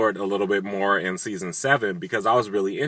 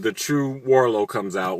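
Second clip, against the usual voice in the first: normal talking voice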